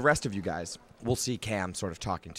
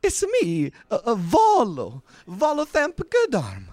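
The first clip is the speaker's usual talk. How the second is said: much higher, eager stammering writer; Volo; 13 semitones higher, 9 dB louder than usual. Acted. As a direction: fuss and fluster like a pompous little chronicler. Volo again.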